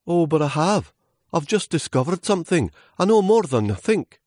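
very high, eager voice; excited little leaf. Acted. Leaf just discovered something, high excited protest to trunk. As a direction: announce it proudly, high and eager.

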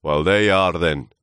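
loud, final, pompous verdict; pronouncing old trunk. Acted. Trunk passing verdict on Lewis, loud pronouncement. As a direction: pronounce it like a judgement. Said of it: "pronounced the trunk".

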